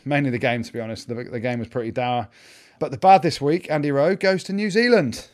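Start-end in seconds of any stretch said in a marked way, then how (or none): 2.8-5.3 higher and louder, grinning announcement; said with a smile. Real, not acted. Naming New Zealand in the bad to co-hosts, gleeful. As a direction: announce it with a cheeky grin. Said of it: "I say that with a smile on my face".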